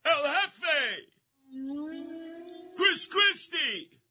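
big, slow, high ring-announcer intro; announcer call. Pretend. Radio host mockingly announcing Chris Christie, slow and high. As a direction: bellow the names like introducing a fighter.

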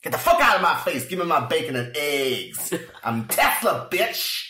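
loud, high angry swagger; gangster Tesla. Retold or imitated. Host voicing Tesla dismissing the clerk, loud cocky anger. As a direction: bark it like a cocky boss. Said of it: "that's what I picture".

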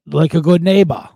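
loud mock Austrian accent; Schwarzenegger. Arnold delivering an insurance slogan in a commercial, loud Austrian accent. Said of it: "making fun of his accent".

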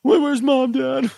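blubbering son. Acted. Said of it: "the blubbering".

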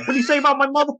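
high-pitched mock indignation; Tony Marinara. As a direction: play the offended wrestler, voice shooting way up.

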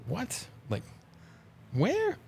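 stunned disbelief. Retold or imitated. Hockey player retelling hearing he was traded, shocked high what. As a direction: pitch jumps way up on where, retold shock. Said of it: "And then I was like".

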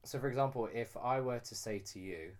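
ordinary talk, nothing marked

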